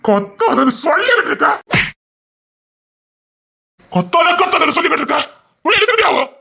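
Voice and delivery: loud, high, exasperated yell; furious shouted command; angry shouting. Acted. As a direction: bellow at her to stop. Husband shouting down screaming wife.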